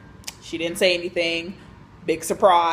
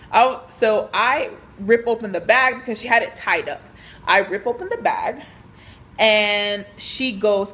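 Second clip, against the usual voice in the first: normal talking voice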